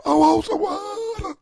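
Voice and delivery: very high, drawn-out mock wail; hammed-up scream. Parent stuck in bed in the back room, mock screaming. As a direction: wail it like a helpless parent begging through cracker crumbs. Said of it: "cracker dust blowing out your mouth".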